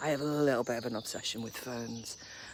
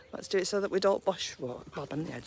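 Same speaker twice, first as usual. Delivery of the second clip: ordinary talk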